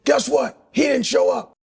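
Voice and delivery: high, punchy bark; David Goggins impression. Goggins mocking the guy who didn't show, high and punchy. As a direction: deliver the punchline with scorn. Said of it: "and he goes".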